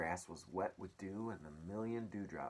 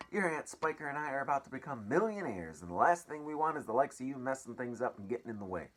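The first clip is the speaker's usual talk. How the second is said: scolding aunt; 6 semitones higher, 6 dB louder than usual. Acted.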